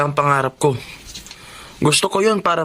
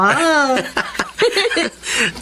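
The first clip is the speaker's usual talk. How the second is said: amused laugh; 8 semitones higher than usual. Acted. Brother laughs at compliment.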